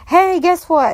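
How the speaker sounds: high, loud, bursting with news; eager announcement. Retold or imitated. Stepkid rushes to tell stepmom news, excited. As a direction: call out like an excited kid.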